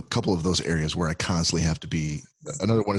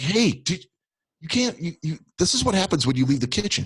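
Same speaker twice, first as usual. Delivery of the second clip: exasperated dad scolding; 10 semitones higher than usual. Retold or imitated. Scolding daughter over gas smell.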